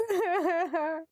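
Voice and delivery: high fake wail, half laughing; hammed-up sob breaking into a giggle; mock sob. Teacher acting out crying over ripped jeans, a fake wail. Why are you crying?.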